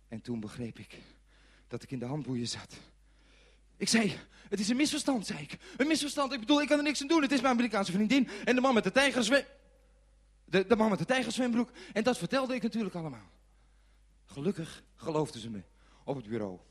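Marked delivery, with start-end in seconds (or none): 3.8-13.3 high, fast, flustered excuses; frantic protest. A narrator's reading. Handcuffed man to police, frantic high protest. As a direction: gabble your defence in a high voice. Pleading with police after arrest.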